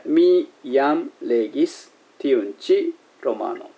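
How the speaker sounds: slow, word by word; slow careful reading. Shown as a demonstration. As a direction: read it out slowly, separating words. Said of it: "ゆっくり読むときは". (ゆっくり区切って読む)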